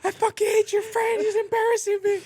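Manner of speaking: put-on very high, loud falsetto girlfriend; angry girlfriend impression. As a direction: screech it like the furious girlfriend. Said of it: "She's like".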